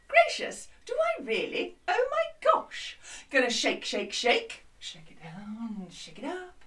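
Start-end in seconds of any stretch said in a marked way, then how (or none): 0.1-3.0 very high, plummy posh lady; hammed-up posh lady exclaiming. Shown as a demonstration. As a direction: go way up into a posh squeal. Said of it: "Gonna talk real posh".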